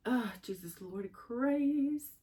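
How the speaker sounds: slow, high, choked-up sigh of emotion; drawn out as tears come; overwhelmed, near tears. Real, not acted. Overcome with emotion on camera.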